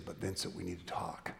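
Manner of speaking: low, soft, serious plea; quietly urgent. Acted. Woman confessing to the man she loves, quietly urgent. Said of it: "He hears the urgency in her voice".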